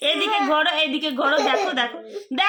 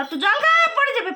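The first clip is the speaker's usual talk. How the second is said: insistent urging; 8 semitones higher, faster than usual. Real, not acted. Mother urging her daughter to drink, more insistent. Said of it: "আচ্ছা, একটু জল খাও".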